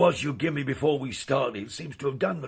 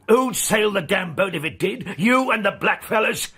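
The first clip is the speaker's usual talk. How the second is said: angry challenge; 3 semitones higher, 4 dB louder than usual. Acted. Skipper bullying crewmate in quarrel.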